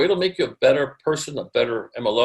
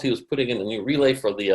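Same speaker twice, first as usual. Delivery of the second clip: normal talking voice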